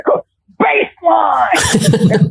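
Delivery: high, long-drawn coach's call; Coach Carter. Retold or imitated. New coach calls to unmoving kids, drawn-out mock movie-coach yell. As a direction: stretch the order out like a movie coach. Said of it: "trying to be like Coach Carter".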